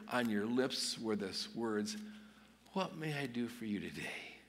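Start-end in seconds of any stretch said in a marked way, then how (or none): 2.7-4.4 soft, unhurried question; gentle offer. Acted. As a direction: offer help softly, as if to yourself. Morning words imagined at home, first take.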